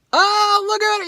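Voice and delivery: very high, loud squeal imitating friends; friends' mock reaction. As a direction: tease the DJ in a squeaky put-on voice. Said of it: "they'd be like".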